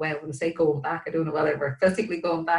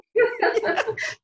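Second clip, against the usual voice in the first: short laugh, much higher; high burst of laughter; 5 dB louder than usual. Real, not acted. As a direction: laugh out at the joke. Laughs at road-sign joke.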